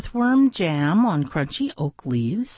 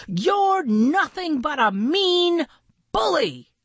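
Maxwell angry; 11 semitones higher than usual. A narrator's reading. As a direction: denounce the bully word by word. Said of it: "Maxwell said".

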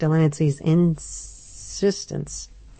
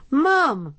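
cry out; 9 semitones higher than usual. A narrator's reading. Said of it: "Adam cried".

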